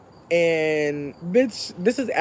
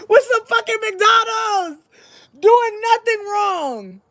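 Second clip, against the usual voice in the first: very high, drawn-out wail of innocence; stretched, high-pitched hammed-up protest; comic wail; 14 semitones higher, slower than usual. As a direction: wail it slowly in mock despair. Wailed protest of their innocence.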